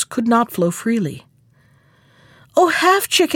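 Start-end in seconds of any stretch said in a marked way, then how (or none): 2.6-3.3 higher-pitched appeal; crying out. A narrator's reading. Choked stream appeals to passing Half-Chick, crying out. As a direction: call out for help, pitch lifted. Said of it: "it cried".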